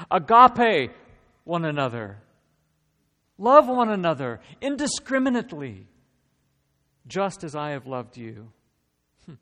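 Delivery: loud, high, ringing preacher's voice; full-force preaching. Acted. Pastor voicing Jesus to the congregation, preaching loudly. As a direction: proclaim the command to the whole room.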